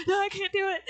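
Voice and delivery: high panicked cry of no; retold scream. Panicking mid-drop, crying out no. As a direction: yelp it out in a panic. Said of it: "I guess I, like, screamed".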